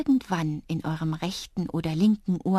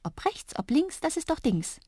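giggly little creature; 9 semitones higher than usual. A narrator's reading. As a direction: tease lightly in a tiny high voice. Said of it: "kicherte Drups". (hohes, kicherndes Stimmchen)

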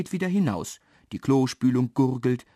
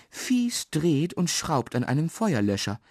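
usual speaking voice

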